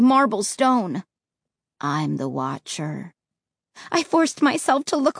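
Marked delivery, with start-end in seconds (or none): 1.8-3.1 deep, slow and cold; low, flat tone. Acted. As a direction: lower and softer, slow and lifeless. Ray voiced low.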